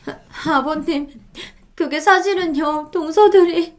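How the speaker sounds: high, tearful, halting confession; tearful pleading. A narrator's reading. Daughter-in-law confiding in her father-in-law, tearful. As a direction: pour it out haltingly through tears. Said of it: "어린아이처럼 눈물을 펑펑 쏟으며 시아버지에게 하소연했어요".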